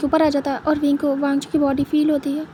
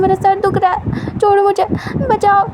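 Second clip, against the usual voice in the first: higher and louder, screaming to be let go; screaming captive; 6 semitones higher, 5 dB louder than usual. Acted. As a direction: yell for rescue at the top of the voice. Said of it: "wing फिर से चिल्लाना start करता है".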